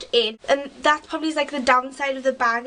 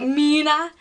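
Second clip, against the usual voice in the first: Geordie accent; 3 semitones higher, 3 dB louder than usual. Shown as a demonstration. Teen mimicking her own Geordie-sounding "Mina". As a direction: say "Mina" the Geordie way, lightly mocking. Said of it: "I said it in a really Geordie accent there".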